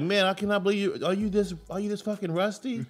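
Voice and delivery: high, laughing disbelief at his wife; half-laughing mock outrage; incredulous scolding. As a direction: scold her for being rusty. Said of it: "So I'm like".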